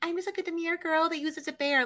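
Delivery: mocking a cutesy girl's voice. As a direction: play a demure cute girl, pitched high. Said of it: "you know, like".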